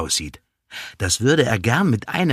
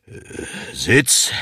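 stricter command. A narrator's reading. Policeman shows how to command a dog, sterner. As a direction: draw breath and order the dog to sit. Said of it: "ein bisschen strenger klingen".

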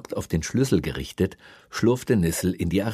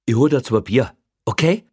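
louder, higher coaxing offer; bargaining policeman; 6 semitones higher, 7 dB louder than usual. A narrator's reading. Policeman bribing the prisoner with beer, coaxing. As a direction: make the offer insistently, voice raised.

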